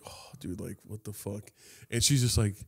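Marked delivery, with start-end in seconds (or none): 0.1-1.5 muttered under the breath, much softer; hushed. Retold or imitated. To the girl outside class, hushed and much softer. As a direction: keep it low so the teacher can't hear. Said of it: "I'm just like".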